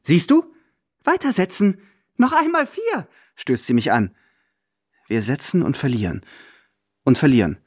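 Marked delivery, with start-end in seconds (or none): 0.0-3.1 very high, triumphant urging; regained courage. Acted. Grandmother urging her companion to bet again, excitedly. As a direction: crow with new hope, pushing him on. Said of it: "Großmutter fasst schon wieder Mut".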